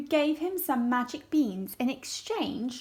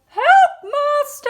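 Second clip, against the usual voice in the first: much higher and louder, slow cry for help; harp crying out; 14 dB louder, slower than usual. Acted. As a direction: cry out like a frightened magic harp. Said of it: "the harp cried".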